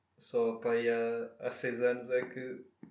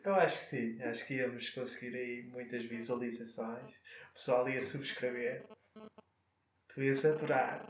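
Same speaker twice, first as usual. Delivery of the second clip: sensual voice; 5 dB quieter than usual. Pretend. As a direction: play it sultry and quiet. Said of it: "achas que é uma voz sensual".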